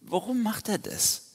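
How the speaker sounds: high-pitched, incredulous why; high, exasperated disbelief; exasperated question. Real, not acted. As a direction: ask it high, baffled by him. Exasperated at Gideon's behaviour.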